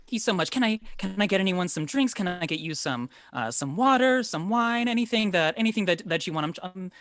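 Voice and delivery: high, hurried, apologetic hostess; over-eager woman stumbling through her hospitality; flustered baker woman. Acted. Game master plays flustered baker Bella.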